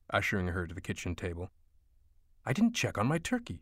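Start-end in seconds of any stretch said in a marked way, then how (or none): 2.5-3.6 flustered mother. A narrator's reading. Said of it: "she claimed, spinning".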